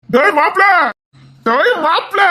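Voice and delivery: high, loud, jubilant shout; excited calling out. Acted. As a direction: holler joyfully to your brother-in-law. Excited calling out to brother-in-law with news.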